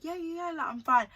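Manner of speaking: high, hurried reassurance, acted out; retold brush-off. Crier telling worried friends she's okay, mock dismissive.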